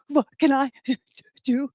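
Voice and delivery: put-on halting, gasping child voice; mock sobbing. Crying child asking the store man for a chore, mock sobbing. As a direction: imitate a kid talking through sobs. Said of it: "I was crying and I was sobbing".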